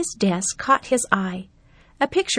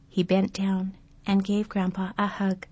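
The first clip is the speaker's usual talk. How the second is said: ordinary delivery, nothing marked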